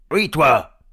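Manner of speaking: loud, high accusation; angry troll. Acted. Hubert accusing Léon, loud anger. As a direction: fire it back loudly. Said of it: "trois trolls en colère".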